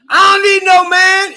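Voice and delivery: high, loud jeering shout; mock yelling crowd. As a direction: yell it like a whole gas station mocking her. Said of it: "was yelling".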